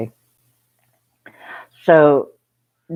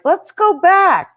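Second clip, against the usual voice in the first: much higher, lively voice; bright high voice; high excited quote; 13 semitones higher, 5 dB louder than usual. Retold or imitated. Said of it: "Joyce is like".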